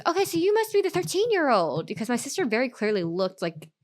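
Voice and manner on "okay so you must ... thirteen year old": fawning stranger. Retold or imitated. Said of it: "and goes".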